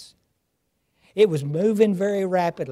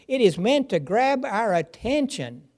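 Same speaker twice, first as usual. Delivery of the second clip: ordinary talk, nothing marked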